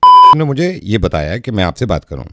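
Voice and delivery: low, measured doctor voice; discreet doctor. Acted. Prank doctor calls about a private matter, discreet low voice. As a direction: speak low and carefully, like a consulting doctor.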